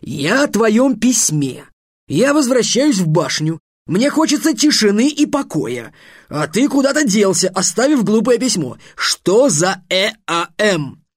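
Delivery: high, emphatic, aggrieved rant; weary master scolding an apprentice; irate wizard master, complaining. Acted.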